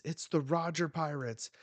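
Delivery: alarmed. Acted. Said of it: "it says".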